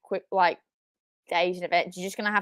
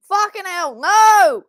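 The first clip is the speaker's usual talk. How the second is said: high, loud outraged yell; furious shout; 10 semitones higher, 9 dB louder, much slower than usual. Real, not acted. Fan watching Bronson fail, furious shout. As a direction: scream your disbelief at the screen.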